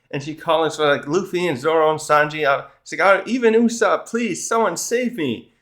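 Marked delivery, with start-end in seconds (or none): 3.1-5.5 high, drawn-out pleading; mock panic. Nami begs even Usopp for rescue, imitated panic. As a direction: play a desperate plea for rescue, hammed-up. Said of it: "now she's panicking".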